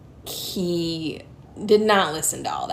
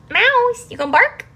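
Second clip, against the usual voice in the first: much higher, louder sing-song to a pet; 13 semitones higher, 8 dB louder than usual. Real, not acted. Greeting her cat Mouse in high baby talk. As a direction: coo at the cat brightly.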